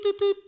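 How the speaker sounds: high, loud beep beep; imitated machine beep. Preacher imitating the machine beeping, high and loud. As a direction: mimic the donation machine beeping. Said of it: "like that".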